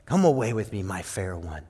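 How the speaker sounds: king speaking tenderly to his bride. Acted. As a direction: say it like a tender lover. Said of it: "He says".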